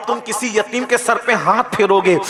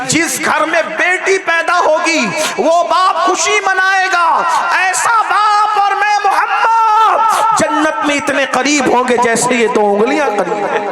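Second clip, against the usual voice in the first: rising proclamation; 8 semitones higher than usual. Acted. The Prophet proclaims to the Arabs that fathers must rejoice at daughters, building to a shout. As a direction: proclaim it like a climax from the pulpit.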